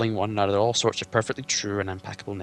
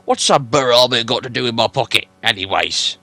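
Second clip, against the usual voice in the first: gruff troll William; 11 dB louder than usual. Acted. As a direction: bellow it like a dim-witted troll.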